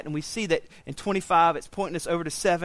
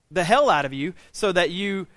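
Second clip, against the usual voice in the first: ordinary talk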